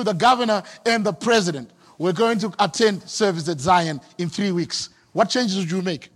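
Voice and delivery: shouting sermon climax at full volume; full-force preaching. Acted. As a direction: press the congregation with every question.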